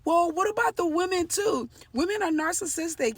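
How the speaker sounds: high, loud mock protest; complaining male viewers. Brothers commenting on her videos about women, high mocking protest. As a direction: imitate objectors piping up in complaint. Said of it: "they're like".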